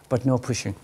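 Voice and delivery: ordinary delivery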